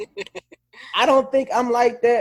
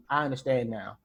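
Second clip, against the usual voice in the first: low, soft acknowledgement; controlled verifying; 7 semitones lower, 6 dB quieter than usual. Acted. Confirming who was addressed, quietly controlled. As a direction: take it in quietly. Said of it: "I verify, I make sure".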